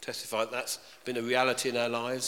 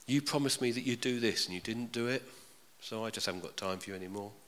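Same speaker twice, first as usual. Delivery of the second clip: dismissive friend; 3 semitones lower, 7 dB quieter than usual. Retold or imitated. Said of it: "the sort of friend who says".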